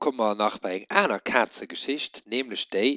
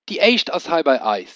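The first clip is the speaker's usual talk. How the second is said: higher, louder smug pronouncement; proud father; 6 semitones higher, 5 dB louder than usual. A narrator's reading. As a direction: declare it like settled fact. Seet mei Pap.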